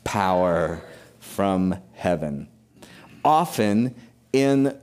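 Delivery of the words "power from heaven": slow unison recital. Shown as a demonstration. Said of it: "let's say it together".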